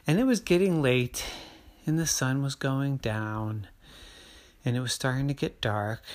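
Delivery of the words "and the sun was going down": usual speaking voice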